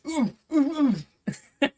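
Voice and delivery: high, mock frightened whimpers; high mocking cowering ooh; mock scared whimper. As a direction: squeal like a scared victim. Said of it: "Then I'd be like".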